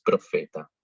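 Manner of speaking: profeta con f clara; ph como f. Shown as a demonstration. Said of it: "igual que la F". (profeta pronunciado con f)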